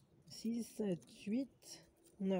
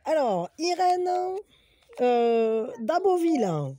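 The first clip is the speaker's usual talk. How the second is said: slow announcing; 2 semitones higher, 5 dB louder, much slower than usual. Real, not acted. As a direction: stretch out the winner's name. Announcing a giveaway winner.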